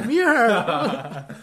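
loud, long-stretched comic question; loud playful demand. Retold or imitated. Quoting their joke about who's funnier, loud and drawn out. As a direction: draw it out loudly, half laughing.

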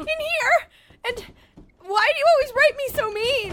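high loud indignant complaint. Acted. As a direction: protest loudly. Upset complaint.

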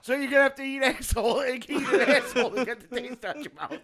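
fast, laughing words. Real, not acted. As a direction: push the words through laughter. Laughing while talking.

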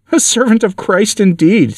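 high, drawn-out sneer. A narrator's reading. Satan scoffing at the preacher's claim, sneering. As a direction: echo it slowly with mocking scorn. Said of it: "said Satan with a sneer".

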